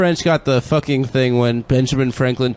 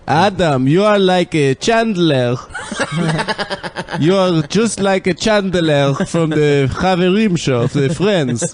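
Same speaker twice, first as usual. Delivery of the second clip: lightly mocked Israeli English, slow; Israeli cousin voice; 4 semitones higher, slower than usual. Israeli cousins telling Adam about Friends, mock accent. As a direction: play an Israeli cousin comparing you to Chandler. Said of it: "My Israeli cousins are like that too".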